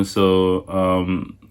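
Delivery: ordinary delivery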